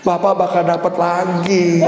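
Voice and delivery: usual speaking voice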